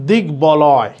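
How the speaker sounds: দিক বলয় said with a clear ব sound; pronunciation demo. Shown as a demonstration. Teacher reading the word to the class clearly. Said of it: "এটা গঠিত হয়েছে".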